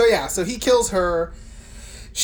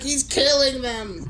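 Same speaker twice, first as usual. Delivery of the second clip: scared; 5 semitones higher, slower than usual. Retold or imitated. Lori telling Buddy about Michael.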